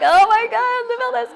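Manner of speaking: high, loud astonished delight; thrilled exclaim. Retold or imitated. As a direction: gasp it out in pure wonder. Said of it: "I was like".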